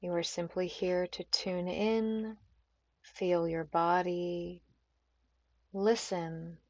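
unhurried meditation guide with long pauses; calm, spacious yoga nidra voice; guided meditation. Acted. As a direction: ease them gently into stillness, phrase by phrase.

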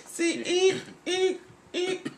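high squeaky eek sounds; mock squeaks. Friend imitating a movie sound, high squeaks. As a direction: squeak it like a door.

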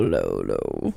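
deep, dropped-down low tone. Shown as a demonstration. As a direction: sink the voice way down. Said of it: "I gotta go low".

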